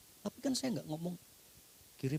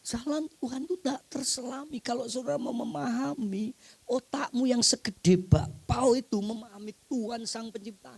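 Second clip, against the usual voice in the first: much higher and louder, a hammering pulpit cry; full-force preaching; 10 semitones higher, 5 dB louder than usual. Acted. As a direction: preach it out to the whole hall. Preacher rising again.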